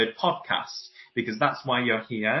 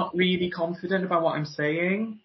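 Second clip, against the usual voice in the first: higher pitch, every phrase rising for agreement; insecure upspeak; 6 semitones higher than usual. Acted. Voice coach voicing an unsure speaker, insecure upspeak. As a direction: plead lightly for agreement with questioning endings. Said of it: "Sounds insecure".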